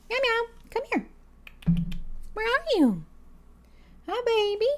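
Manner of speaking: high, soft coaxing pet voice. Real, not acted. Streamer coaxing her hiding cat, high cooing voice. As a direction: coo at the kitten to come out. Calling the kitten over.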